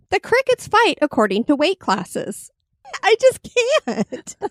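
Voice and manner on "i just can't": breaking into giggles; can't hold it together; laughing helplessly. Real, not acted. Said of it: "this is tough to get through".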